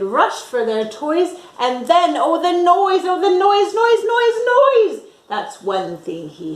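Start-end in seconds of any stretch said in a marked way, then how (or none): 1.6-5.1 rising higher and louder on each noise; building to a cry. A narrator's reading. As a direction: climb to a shrieking noise.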